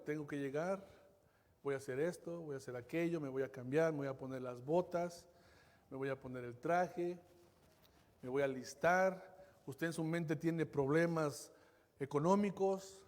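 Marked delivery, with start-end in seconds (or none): 0.0-9.5 low, soft listing of chores to oneself; lightly voiced inner monologue. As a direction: lightly play a worker running through the routine. Voicing a worker's routine thoughts.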